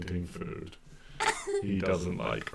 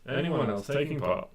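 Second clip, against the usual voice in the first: raised crier's call; 5 semitones higher, 5 dB louder than usual. Acted. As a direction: call to the crowd for entrants. Crier calling for competitors again.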